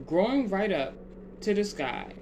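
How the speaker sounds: ordinary delivery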